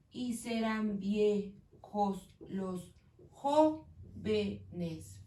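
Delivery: very slow, each syllable apart; very slow syllable count. Shown as a demonstration. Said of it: "tengo nueve sílabas".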